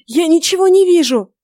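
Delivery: high, loud, panicked cry; acted panic, pitched high; horrified cry. A narrator's reading. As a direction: cry out in terror at going blind. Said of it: "с неподдельным ужасом закричал он".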